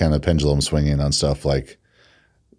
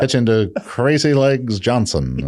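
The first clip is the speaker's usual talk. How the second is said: put-on old-timey radio announcer, pitched up; slow, pitched-up ballpark call; 1950s narrator; 9 semitones higher, 3 dB louder, slower than usual. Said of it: "why did they stop talking like that".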